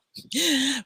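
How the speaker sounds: sudden high gasp of discovery; delighted gasp. Real, not acted. Finding the video in her filing cabinet, a delighted gasp. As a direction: gasp as you find the thing you lost. Finds the video she wanted.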